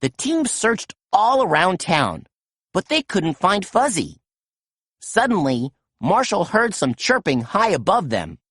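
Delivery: ordinary delivery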